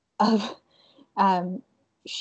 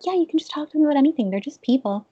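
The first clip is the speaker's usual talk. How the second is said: coaching friend voice; 6 semitones higher, 3 dB louder, much faster than usual. Retold or imitated. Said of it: "she's like".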